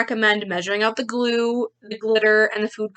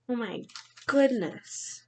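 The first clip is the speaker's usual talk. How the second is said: much softer breathy oh my goodness; hushed amazement; 8 dB quieter, slower than usual. Real, not acted. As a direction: say it under your breath in awe. Marvels at the picture.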